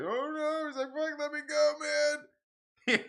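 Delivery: high mock panicked plea; scared pleading. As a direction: beg to be let go, voice up high.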